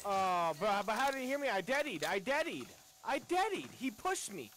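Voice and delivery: high frantic disbelief; panicking gamer. Real, not acted. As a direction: pitch shooting up in outrage. Gamer panics after being killed.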